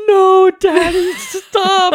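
put-on very high, loud, drawn-out child's wail; imitated kids yelling. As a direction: mimic little girls shrieking at dad. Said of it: "they're yelling at me".

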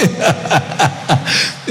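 quick run of real laughter; hearty laugh. Real, not acted. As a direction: laugh heartily at your own teasing. Laughs after the joking greeting.

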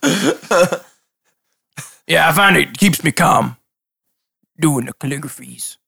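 lightly voiced dim fisherman; a hint of a cheerful simple NPC; Toko voice.

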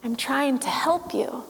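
gentle Gandalf. Retold or imitated. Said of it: "Gandalf leans in".

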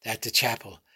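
ordinary delivery, nothing marked

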